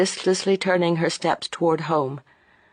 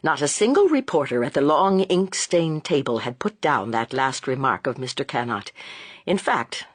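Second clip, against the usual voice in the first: ordinary delivery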